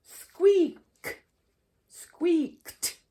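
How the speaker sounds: "squeaked" ending with a T, skweekt. Shown as a demonstration. Teacher showing the T ending with a new verb. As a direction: clip "squeaked" to a T at the end. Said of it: "And this example".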